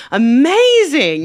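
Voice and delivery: imitated awestruck delight; delighted approval. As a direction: gush slowly as the won-over fan. Woman won over, retold.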